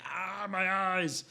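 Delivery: slow, high, drawn-out cry of agony; a slow, high, hammed-up howl of pain; pained monster wail. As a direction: wail like a blinded creature. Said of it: "he's like".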